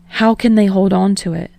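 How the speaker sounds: ordinary delivery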